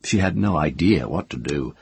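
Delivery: ordinary delivery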